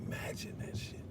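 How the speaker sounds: breathy whispered aside. Real, not acted. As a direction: drop to a hushed whisper, savoring it. Savoring an imagined scenario.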